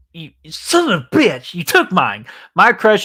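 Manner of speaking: hammed-up indignant shout; mock outrage. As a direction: pretend to be robbed, half-laughing fury.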